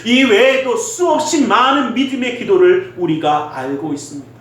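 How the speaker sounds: ordinary delivery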